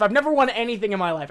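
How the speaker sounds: ordinary delivery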